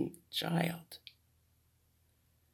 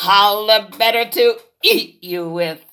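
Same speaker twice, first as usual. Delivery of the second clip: wolf as grandma; 5 semitones higher, 7 dB louder than usual. Acted. Wolf answers.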